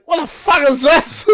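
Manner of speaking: loud, high shocked outburst; mock outraged yell. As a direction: bellow at the dog mess. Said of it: "And I go".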